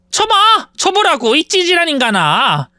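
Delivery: high-pitched, goading challenge falling into scorn; defiant dare. Acted. Sister daring her brother to hit her, defiant.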